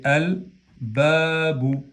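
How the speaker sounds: "al babu" slow, with the L of al fully sounded; lam pronounced. Shown as a demonstration. Teacher demonstrating the article before ba, slowly pronounced lam. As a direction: draw out "al babu", lam clearly audible. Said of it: "en este caso pronunciamos la lam de al".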